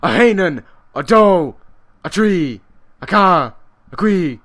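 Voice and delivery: loud, high count in Irish, one punchy shout per number. Real, not acted. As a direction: bellow each Irish number along with the blows. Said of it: "finally able to do that in Irish".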